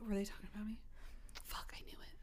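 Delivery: very soft, paranoid imitated whisper; furtive classroom whisper. Imagining classmates talking about her, furtive whisper. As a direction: sink into a suspicious whisper. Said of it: "like new students in class".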